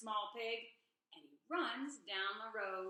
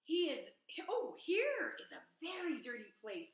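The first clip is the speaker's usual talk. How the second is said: excited; 6 semitones higher, 6 dB louder than usual. A narrator's reading. Pig excited at junkyard.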